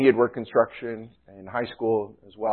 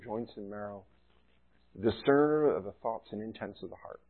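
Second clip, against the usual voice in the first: softer, low-pitched public prayer; 3 semitones lower, 9 dB quieter than usual. Real, not acted. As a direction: pray quietly and earnestly to God. Leads an opening prayer.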